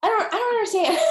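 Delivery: high and loud, laughing it out; can't believe it, laughing; high, loud laughing exasperation; laughing disbelief. Real, not acted. Laughing exasperation after quote.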